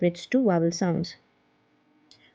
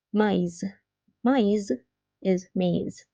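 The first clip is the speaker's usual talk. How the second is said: ordinary talk, nothing marked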